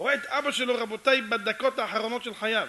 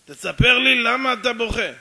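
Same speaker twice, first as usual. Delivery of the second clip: slow, very loud repeated order; acted stubborn shouting; insistent demand; 5 semitones higher, 12 dB louder than usual. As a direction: bellow it slowly, not budging. Stranger repeats his demand.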